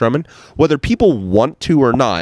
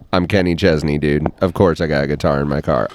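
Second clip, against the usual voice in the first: lower, fast laid-back star voice; 8 semitones lower, faster than usual. Retold or imitated. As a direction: toss it off like a bored celebrity. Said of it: "And he'd be like".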